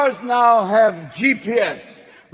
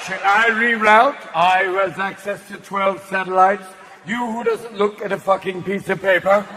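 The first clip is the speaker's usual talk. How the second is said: snooty English GPS; 2 semitones lower than usual. Retold or imitated. The English GPS scolding the driver, condescending English. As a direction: sneer it in prim English. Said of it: "bitchy English voice".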